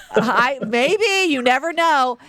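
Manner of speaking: playfully noncommittal. Retold or imitated. Cousin humoring kids hoping to see a star, playfully evasive. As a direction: dodge the question with feigned hope. Retells dodging the kids' hopeful question.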